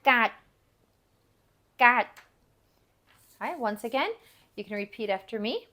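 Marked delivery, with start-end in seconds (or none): 0.0-2.1 loud, isolated "Gat" cut off at a silent stop; unreleased final consonant. Shown as a demonstration. Teacher demonstrates the silent ending, "Gat" with final unreleased. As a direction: end "Gat" on the tongue position, no release. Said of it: "it is not pronounced in any way".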